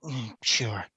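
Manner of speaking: grudging mumbled permission; quiet, reluctant agreement; low grunt. Acted. Oz grudgingly shares bottle.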